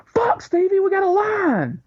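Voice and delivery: high squeaky mock voice of an impatient wrestler, laughing; hammed-up high-pitched impression, slowing on the line; Booker T. Wrestler hurrying his handler along, high mock squeak with laughter. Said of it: "And Booker goes".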